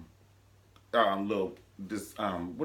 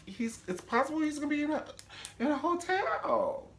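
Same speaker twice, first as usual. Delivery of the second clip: much higher, dismayed whine; high mock fretting. Mother refusing her baby, high dismayed whine. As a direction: wail the worry. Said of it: "Candace was like".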